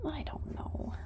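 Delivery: soft whispered horse voice; unsure mare, whispered; half-whispered voicing of a doubtful horse. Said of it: "She's like".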